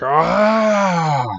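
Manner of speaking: high hit yelp from the beast; monster pain cry. Acted. Machoke hit in the face, high pained yelp. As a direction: cry out as the beam lands. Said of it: "Machoke is like".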